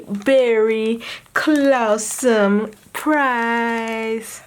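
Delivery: very slow, drawn-out cheesy pun; corny pun. Acted. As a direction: stretch the pun out, hamming it. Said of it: "That was lame".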